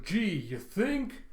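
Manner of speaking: high, drawn-out sarcasm; sarcastic retort. Acted. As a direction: stretch it out in mock amazement. Plugger retorts to the warning.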